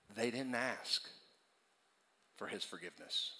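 very soft, low, spaced-out phrases; hushed, solemn. Real, not acted. Preacher urging forgiveness on the congregation, hushed and solemn. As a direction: let it sink in quietly.

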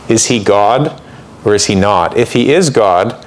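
usual speaking voice

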